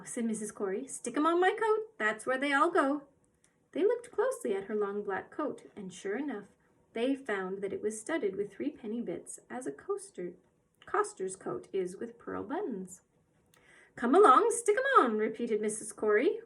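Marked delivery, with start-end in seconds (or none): 0.9-3.0 put-on brisk little old woman. Mrs Corey's cockney voice. 13.9-15.2 eager urging. Acted. Mrs Corey urging.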